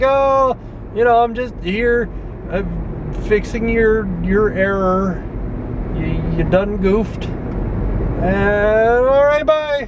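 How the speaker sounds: high, halting mock-sheepish excuse; awkward, embarrassed. Caught by the cashier while fixing his error, awkwardly hammed-up. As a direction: stall and stretch words, ending in a high squeaky goodbye.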